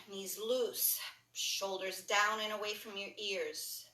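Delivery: calm yoga instructor leading a class; guided yoga cueing. Acted. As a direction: talk them through each stretch gently. Guides a breathing yoga sequence.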